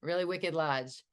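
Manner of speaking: Boston accent. Shown as a demonstration. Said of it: "your Boston accent".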